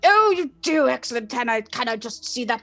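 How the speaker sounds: lightly voiced old man; a hint of a doddery old librarian; older male librarian. Librarian checks student's card, lightly elderly voice. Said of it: "sort of an older man".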